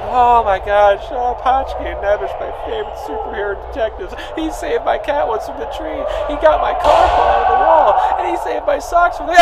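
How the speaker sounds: mocking inmates. Inmate mocks crying fellow prisoners, mock weepy. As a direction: mimic the weepy fans sarcastically. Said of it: "Everyone's going".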